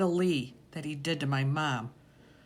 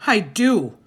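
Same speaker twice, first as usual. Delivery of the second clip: louder, defiant; insistent retort; 7 semitones higher, 11 dB louder than usual. A narrator's reading. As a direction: hold your ground. Boy insists against father.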